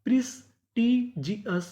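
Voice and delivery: "प्रिस्टीजियस" slowly, broken into its parts; syllable-by-syllable reading. Shown as a demonstration. Teacher building the word for students, slow separated syllables in "प्रिस्टीजियस". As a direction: sound out "प्रिस्टीजियस" piece by piece for learners. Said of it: "तो meaning क्या बनी".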